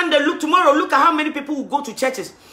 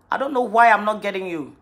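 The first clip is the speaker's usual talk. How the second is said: normal talking voice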